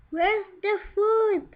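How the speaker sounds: high piggy question. Acted. Greedy Pig asking for food, high and eager. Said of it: "asked Greedy Pig".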